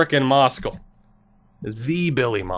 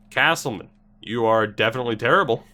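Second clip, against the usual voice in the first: normal talking voice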